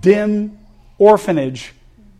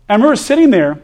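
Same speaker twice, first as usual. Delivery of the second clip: ordinary talk, nothing marked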